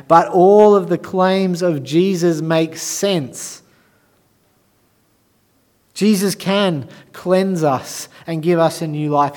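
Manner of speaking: a triumphant Easter sermon, building and loud; preaching at full force. Acted. Rising resurrection proclamation.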